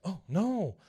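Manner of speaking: a sinking realization, retold; dismayed. Doctor finding the safe gone, dismayed.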